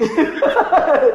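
high, loud burst of laughter; real, hearty laugh. Real, not acted. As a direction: crack up out loud. Laughs at own joke.

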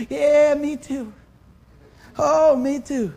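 a loud, whiny me-too; mock whining. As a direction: moan along like a coworker joining the complaint. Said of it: "this one starts whining".

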